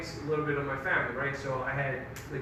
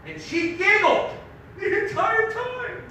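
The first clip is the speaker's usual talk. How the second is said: incredulous; 8 dB louder, slower than usual. Real, not acted. Building outrage at mom.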